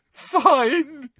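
high, sobbed word; sobbing. A narrator's reading. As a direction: sob the word out. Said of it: "now sobbing into her hands".

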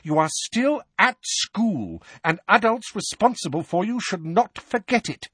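high, emphatic lecture; heated scolding. A narrator's reading. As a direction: scold firmly, pitched high.

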